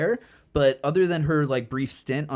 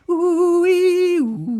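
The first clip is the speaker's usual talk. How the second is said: high sung ooh ee ooh; theremin imitation; 8 dB louder, much slower than usual. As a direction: croon it like a spooky theremin. Imitating theremin horror soundtrack.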